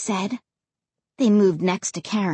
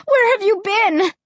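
high-pitched call to parents; excited cry; 10 semitones higher, 3 dB louder than usual. A narrator's reading. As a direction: call out urgently. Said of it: "I cried".

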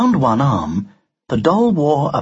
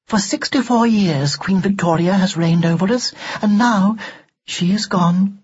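higher, formal doll voice; prim, reproving doll; 5 semitones higher than usual. Acted. Doll to Ned about her great Queen Empress, reprovingly. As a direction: lecture proudly in a high, precise voice.

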